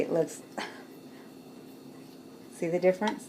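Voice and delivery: breathy laugh through the question. Real, not acted. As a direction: giggle the words out, half whispered. Showing portions, laughing at herself.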